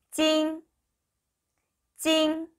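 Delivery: 찡 stretched high and level; teacher's sustained high 찡; model pronunciation. Shown as a demonstration. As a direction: hold 찡 ringing on an even high note. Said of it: "읽으시면 되고요".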